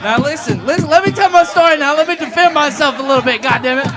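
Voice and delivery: high, shouted self-defence; loud mock-indignant protest. Real, not acted. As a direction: shout over the crowd to take back the floor. Defends himself over audience heckling.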